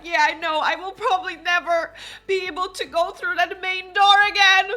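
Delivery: high, loud lament building to the end; melodramatic mother. Pretend. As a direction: wail like a mock-traumatised widow. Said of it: "Elsa's mom is like".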